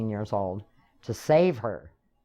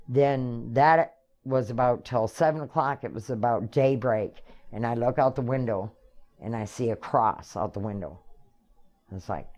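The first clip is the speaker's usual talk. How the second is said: normal talking voice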